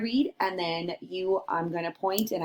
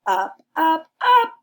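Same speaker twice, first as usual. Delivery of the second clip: each up higher and louder; rising up; 7 semitones higher, 8 dB louder than usual. Acted. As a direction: climb the ladder with your voice. Climbing up, voice rising.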